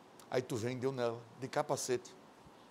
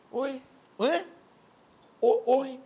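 playing deaf; 10 semitones higher, slower than usual. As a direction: act like you didn't hear. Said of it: "Dá de moco, de surdo".

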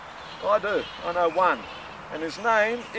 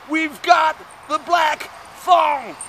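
slow, high, shouted introduction; big announcement; 6 semitones higher, 4 dB louder, slower than usual. Acted. Comic introducing his thong to crowd, shouted announcement. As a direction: hype it like a ringmaster.

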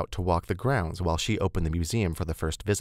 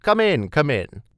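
high, louder eager woman's character voice; Beth, gushing welcome; 9 semitones higher, 7 dB louder than usual. Acted. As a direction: gush a welcome, pitched well up.

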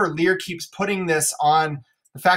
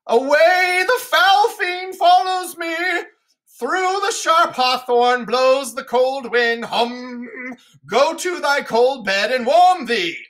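mad poor Tom; 7 semitones higher, slower than usual. Acted. Edgar disguised as poor Tom raving at Lear, high and slow. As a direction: rave like a madman fleeing fiends.

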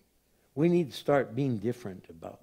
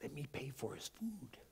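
hushed aside to the clerk; discreet whisper; 16 dB quieter than usual. Retold or imitated. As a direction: whisper it so nobody else hears. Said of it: "I'll just tell the clerk".

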